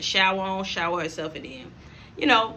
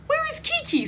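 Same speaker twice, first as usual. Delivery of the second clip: mock panic. Real, not acted. Looking around for the missing ride, high and alarmed. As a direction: cry out in panic.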